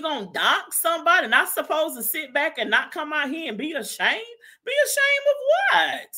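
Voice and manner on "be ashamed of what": sudden high shout of outrage; indignant shout. Real, not acted. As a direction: yell it out, offended. Indignant rant peak.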